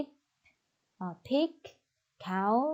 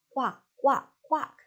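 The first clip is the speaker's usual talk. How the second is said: imitating a duck quack; 4 semitones higher than usual. Shown as a demonstration. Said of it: "And what's its sound?".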